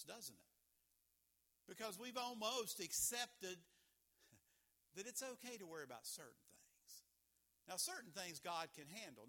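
ordinary talk, nothing marked

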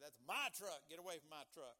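loud, possessive punch on "my"; stress on my. Acted. Preacher playing a possessive truck owner, loud stress on "my". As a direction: claim it with a hard hit on "my".

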